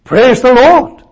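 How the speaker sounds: high, loud cry of praise the Lord. Retold or imitated. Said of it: "So he said".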